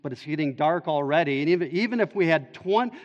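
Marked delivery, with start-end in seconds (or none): 1.4-2.9 climbing higher and louder, slowing on "two hundred denarii"; rising exasperation. Retold or imitated. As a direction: build the protest to its peak. Disciples voiced protesting the cost.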